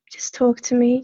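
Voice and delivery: ordinary delivery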